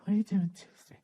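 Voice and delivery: mock-sweet question, a hint of caricature; caricatured wife voice. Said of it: "She doesn't talk like that".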